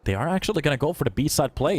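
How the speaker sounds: ordinary delivery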